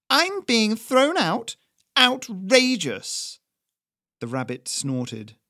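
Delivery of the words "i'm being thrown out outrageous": slow, high, loud affronted cry; outraged. Acted. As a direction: stretch each word in outrage. Doris thrown out.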